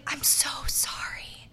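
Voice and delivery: sheepish whispered apology. Retold or imitated. Caught naked by the RA, a guilty whisper. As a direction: hush it, caught out and embarrassed.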